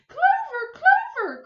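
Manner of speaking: very high, loud panicked call; frantic cry. Acted. Mother screaming for Clover in the water. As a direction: scream for the drowning child. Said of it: "cried Missus Hipple".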